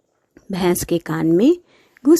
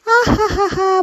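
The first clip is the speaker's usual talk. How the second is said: joyful squeal; 13 semitones higher, slower than usual. Acted. Girl squeals with joy.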